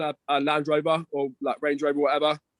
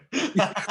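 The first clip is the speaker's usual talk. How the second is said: amused laugh; 5 semitones higher than usual. Real, not acted. Amused by friend's look.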